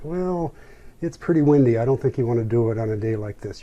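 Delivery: cautioning rental man. Retold or imitated. Rental man warns a novice about the wind, cautioning.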